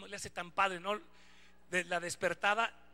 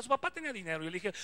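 normal talking voice

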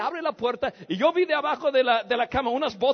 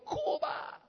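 high, sustained trumpeting mimicry; trumpet-like cry; 7 dB quieter than usual. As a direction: imitate a trumpeting call at reduced volume. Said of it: "como una trompeta, ¿no? Me, me gritó".

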